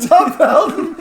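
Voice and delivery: high, loud laughing disbelief; mock surprise. Cohost hearing who is at the window, laughing mock surprise. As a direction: squeal the name in fake amazement.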